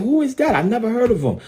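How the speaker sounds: high-pitched mock bafflement; imitated dismissive puzzlement. Pastor voicing rappers who never heard of rival, mock puzzled. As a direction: quote them high, as if clueless.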